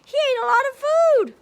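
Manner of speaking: high, loud and fast; excited exclaim. Acted. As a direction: burst out with it for the kids. Teacher exclaims to children.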